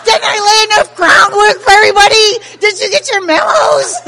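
very high, very loud rant; hysterical screaming. Retold or imitated. Mother scolding everybody at the reception, hysterical screaming. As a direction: shriek it at the whole crowd. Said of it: "And I'm screaming, I'm yelling".

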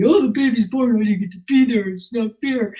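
self-pitying whine, put on; imitating a tearful, poor-me complaint; mock tearful whining. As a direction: whine for sympathy as if starting to cry. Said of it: "So I start crying. You know, now I want the sympathy".

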